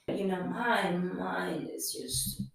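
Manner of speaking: dropping to a near whisper, slow; a stunned murmur under the breath; hushed aside. Real, not acted. Alone on the table, barely voicing her thoughts.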